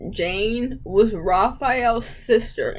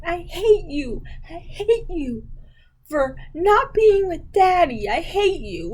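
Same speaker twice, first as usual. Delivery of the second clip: put-on whiny child; 7 semitones higher than usual. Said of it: "She's like".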